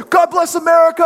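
high, loud slogan shout; politician's rally shout. Retold or imitated. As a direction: whip the crowd up like a politician. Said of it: "they'll go".

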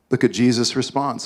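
ordinary talk, nothing marked

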